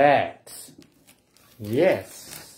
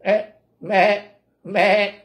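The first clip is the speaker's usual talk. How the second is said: higher, wavering goat bleat; 6 semitones higher, 3 dB louder than usual. Acted. Reader voices the goat for children, bleating. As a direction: bleat like a goat in short bursts. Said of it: "said the goat".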